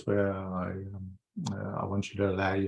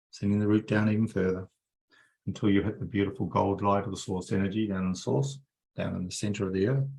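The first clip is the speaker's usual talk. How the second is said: guided meditation. Acted. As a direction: ease them down into calm. Leads a guided energy meditation.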